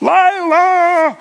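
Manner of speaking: high, loud call of a dog's name. Retold or imitated. Frantic owner calling her lost dog up the hill, loud high call. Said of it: "She's shouting it so many times".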